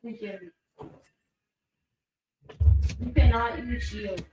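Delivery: loud scolding yell at teammate. Real, not acted. Gamer yelling rule at teammate.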